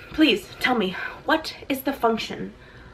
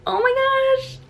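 squeal; 13 semitones higher than usual. Real, not acted. Unboxing, gushing at letter.

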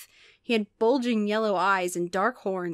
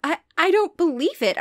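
high, stammering shock; higher, halting and stunned; stammered disbelief; 8 semitones higher, 4 dB louder than usual. A narrator's reading. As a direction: stumble on the first word in disbelief. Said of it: "I stammered".

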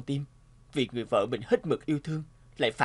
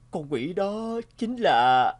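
very slow, higher and louder build-up; slow dramatic reveal; 4 semitones higher, 5 dB louder, much slower than usual. Acted. Husband slowly revealing who the demon really is. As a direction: drag out each word before the reveal.

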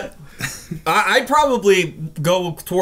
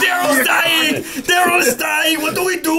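mock panic; 7 semitones higher, slower than usual. Mock panic in action game.

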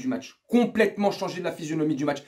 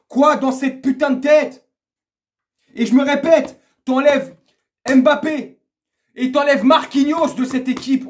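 loud, high, hammered phrases; angry rant; 6 semitones higher, 8 dB louder, slower than usual. Real, not acted. Fan berating players' mentality, angry shouting. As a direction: hammer each point at full voice. Ranting at the team.

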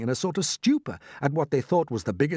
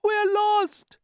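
very high-pitched wail of doom; despairing cry; 3 dB louder than usual. A narrator's reading. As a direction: wail as a frightened ladybird. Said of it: "cried the ladybird".

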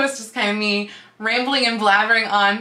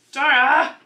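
shouting for the pet; calling out; 4 semitones higher, 5 dB louder than usual. Real, not acted. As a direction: call the name loudly across the room. Calling pet while covered in fur.

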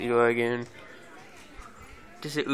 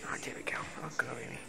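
soft unvoiced whisper; 14 dB quieter, much faster than usual. Real, not acted. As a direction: breathe the words without voice. Whispered aside.

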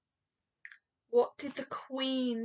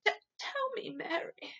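strangled whisper; 7 semitones higher, 5 dB quieter than usual. Acted. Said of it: "strange, strangled sort of whisper".

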